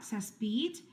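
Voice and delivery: plain reading voice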